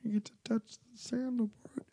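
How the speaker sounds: excited hushed whisper. Real, not acted. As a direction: whisper it with glee. Sneaking around.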